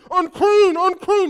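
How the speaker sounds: high, very loud warning cry; lepers calling out. Retold or imitated. Lepers pushing through the crowd, loud high cry.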